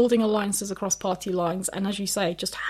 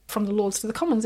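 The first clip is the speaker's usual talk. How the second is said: ordinary talk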